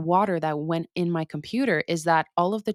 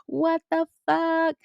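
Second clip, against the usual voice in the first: high, drawn-out mock whine; retold exasperated wail; 10 semitones higher, slower than usual. Traveller blowing water out of charging outlets, high exasperated wail. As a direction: draw it out high, a helpless little wail. Retold reaction to everything going wrong.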